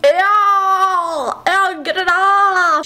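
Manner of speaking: high, wailed mock disgust; [name]. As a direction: squeal in exaggerated disgust. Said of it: "Hey, I'm [name]".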